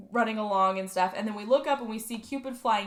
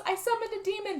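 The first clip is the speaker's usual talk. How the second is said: higher, small daughter voice; daughter confessing; 10 semitones higher, faster than usual. Retold or imitated. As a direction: have the daughter sheepishly admit it. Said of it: "And she goes".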